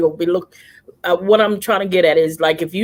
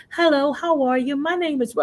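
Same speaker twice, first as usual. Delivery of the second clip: higher, extra-polite greeting for strangers; raised polite voice; 7 semitones higher, 4 dB quieter than usual. Retold or imitated. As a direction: put on a proper customer-service voice. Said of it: "my voice would raise and everything".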